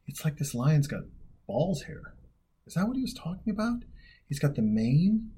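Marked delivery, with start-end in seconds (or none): 2.7-5.3 higher, louder, quick realization; excited discovery. Real, not acted. To viewers, spotting the lion's mane, excited. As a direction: point it out as it clicks.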